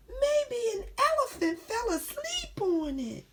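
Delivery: high-pitched storybook voice; character's wild guess. Acted.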